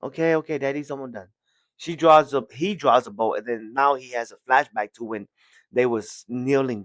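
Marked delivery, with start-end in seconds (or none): 0.0-1.3 아이에게 속삭이듯 아주 부드럽게 말하는 아빠; 다정한 아빠 목소리로 낮고 조용하게; soft fatherly voice. Acted. Father gently talks to child.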